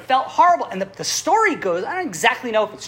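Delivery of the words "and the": usual speaking voice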